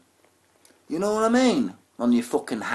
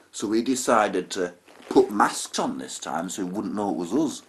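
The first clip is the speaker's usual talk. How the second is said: ordinary talk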